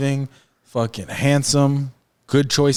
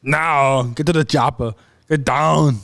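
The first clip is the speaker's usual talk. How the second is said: Arnold Schwarzenegger; slower than usual. Shown as a demonstration. Showing off movie catchphrases to his friend, hammed-up Austrian accent. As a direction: build a mock Austrian accent into urgent commands. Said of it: "my Arnold impersonation is".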